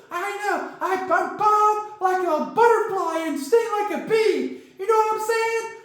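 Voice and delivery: high puppet crocodile voice, bragging; squeaky croc boasting about his punch; Corky boasting. Acted. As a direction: crow like a cartoon boxer croc. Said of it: "calm down, Corky".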